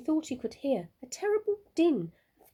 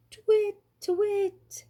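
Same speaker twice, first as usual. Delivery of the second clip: high, louder owl hoot; owl calling out; 12 semitones higher, 6 dB louder, slower than usual. A narrator's reading. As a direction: call out across the woods like an owl. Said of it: "called out".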